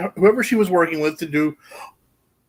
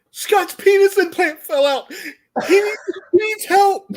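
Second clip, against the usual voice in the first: hysterical panic; 11 semitones higher than usual. Retold or imitated. Coworker's hysterical report to the boss.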